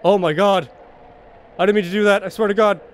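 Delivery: loud, high, frightened outcry; panicked pleading. Real, not acted. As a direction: beg for mercy in a panic. Panics after accidental click.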